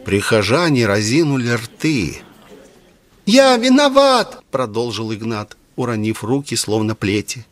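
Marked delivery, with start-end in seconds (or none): 3.3-4.4 high, slow falsetto, trembling with agitation; quavering falsetto. A narrator's reading. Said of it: "дребезжащим от волнения фальцетом".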